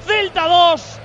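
high, loud, stretched-out goal recap; breakneck goal commentary. Real, not acted. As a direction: keep shouting with joy over the replay. Goal replay after the score.

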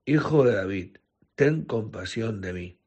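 ordinary delivery

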